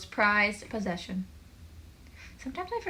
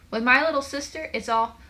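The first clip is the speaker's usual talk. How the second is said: high, loud griping; fed-up complaint; 5 semitones higher, 7 dB louder than usual. A narrator's reading. Joel complains about a little sister, fed up. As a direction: launch loudly into a grievance.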